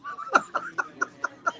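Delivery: very high-pitched helpless laughter; laughing fit. Real, not acted. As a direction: crack up at something dumb. Cracking up at extinction joke.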